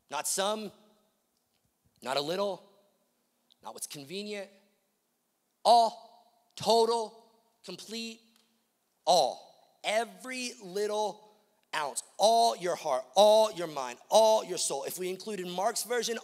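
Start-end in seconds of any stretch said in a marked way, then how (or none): 5.6-14.8 "all" hit loud each time it comes; emphatic preacher hammering "all" with loud bursts; stress on all. Shown as a demonstration. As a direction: punch every "all" well above the words around it. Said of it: "love isn't even the most repeated word".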